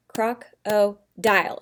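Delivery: "croc-o-dile" slowly, in three separate beats; syllable by syllable. Shown as a demonstration. Teacher reviewing syllables with pupils, "croc-o-dile" broken into three slow parts. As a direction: pause between each part of "croc-o-dile". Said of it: "So now I've got croc-o".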